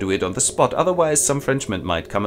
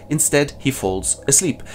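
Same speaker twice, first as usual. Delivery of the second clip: ordinary talk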